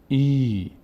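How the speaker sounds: ای held as a long "eee". Shown as a demonstration. Teacher repeating the lengthened reading, long ee on ای. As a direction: draw ای out on its own.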